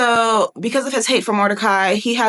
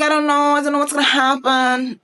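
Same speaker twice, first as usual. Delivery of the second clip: mock a reluctant queen, pitched up and sustained; hesitant Esther; 6 semitones higher than usual. Preacher voicing Esther's reply to Mordecai, mock hesitant.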